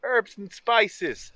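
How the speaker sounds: slow, loud, high mock Jamaican drawl on herbs and spices; terrible Jamaican accent. Cooking outdoors, mocking up a Jamaican accent, badly. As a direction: ham up a bad Jamaican accent, stretching each word. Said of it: "That was a terrible Jamaican accent".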